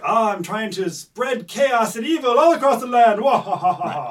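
loud, high, hammed-up evil proclamation with booming laugh; cartoon villain. Mocking villains who spread chaos and evil, hammed-up villain voice. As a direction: bellow like an over-the-top evil mastermind, cackling at the end.

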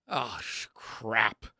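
a hushed, swallowed curse under the breath; soft groaning curse, cut off and corrected; muttered curse. Retold or imitated. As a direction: mutter it to yourself as the dread sinks in. Inner curse on realizing trouble.

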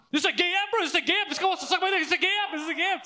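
high panicked repeating; mock freak-out. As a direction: ham up the friend's high-pitched panic. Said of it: "So he freaks out".

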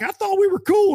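high, incredulous squeak; confused protest. Retold or imitated. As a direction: play it baffled and wounded. Retold confused confrontation.

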